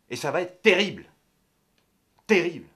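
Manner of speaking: loud, high emphasis on "terrible"; emphatic prophecy of doom; dire warning. Acted. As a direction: hit "terrible" hard both times. Dire warning within the rant.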